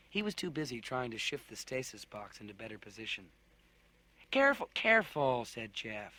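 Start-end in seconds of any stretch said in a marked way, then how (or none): 4.3-5.4 quick urgent caution; urgent warning. Acted. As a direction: hold them back, urgently. Jeff urging caution.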